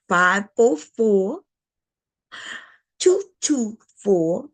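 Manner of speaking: slow, deliberate number read-out; drawn-out dictation of a phone number. Shown as a demonstration. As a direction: spell each digit out slowly for the viewers. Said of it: "but I'm gonna say it slower".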